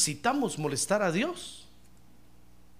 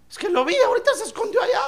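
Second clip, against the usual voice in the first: high, fast and breathless with a laugh; laughing panic; 13 semitones higher, 3 dB louder, faster than usual. Retold or imitated. A scared disciple explaining the scream, high, fast and laughing. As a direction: blurt it out, half laughing in fear.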